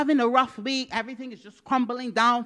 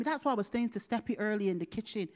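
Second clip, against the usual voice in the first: ordinary talk, nothing marked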